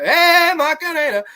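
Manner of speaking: high, loud hoot; hammed-up high-pitched yell; excited shout. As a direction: holler it out high, like a party cheer. Breaks out shouting the name.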